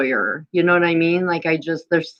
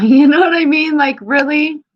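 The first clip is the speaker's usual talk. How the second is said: worked-up disbelief; 11 semitones higher, 6 dB louder than usual. Real, not acted. Indignant venting about the job.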